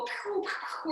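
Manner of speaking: playful "pew" sound effects; imitated toy noises. Teacher showing how she plays beside a crying child, playful noises. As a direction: make little play-shooting noises. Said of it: "And I'll play, and we'll go".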